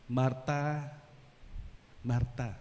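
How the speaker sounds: low, soft and calm; gentle. Acted. Jesus answering upset Martha, gently. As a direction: say her name softly to calm her. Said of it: "his reaction was gentle".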